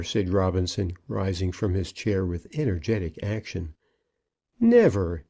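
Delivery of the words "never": loud, falling exclamation; firm, booming denial; emphatic protest. A narrator's reading. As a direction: refuse with full force. Robinson repeats his protest.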